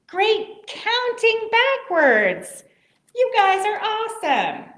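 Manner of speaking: excited praise. Real, not acted. Praising kids after countdown.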